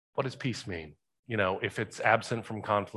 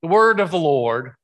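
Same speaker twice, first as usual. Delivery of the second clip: the word of the Lord rung out higher and louder; solemn loud proclamation; stress on word; 5 semitones higher, 8 dB louder than usual. Real, not acted. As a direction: proclaim it with weight. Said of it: "famous Old Testament phrase".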